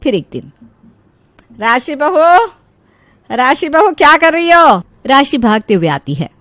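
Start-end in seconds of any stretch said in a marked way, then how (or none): 1.6-4.8 impatient mother-in-law's raised call. Acted. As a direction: summon her from across the house. Mother-in-law calls from another room.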